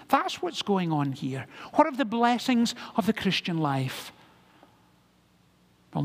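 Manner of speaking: normal talking voice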